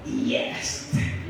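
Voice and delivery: breathy scoffing whisper, barely voiced; mocking whisper. Classmates scoffing at the pastor, whispered. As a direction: sneer it under your breath.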